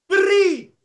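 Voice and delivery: high, loud, firm single command; voice of God. Retold or imitated. God commanding the pastor to give it away, firm. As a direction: deliver it as God's terse order. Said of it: "Tuhan bicara di telingaku".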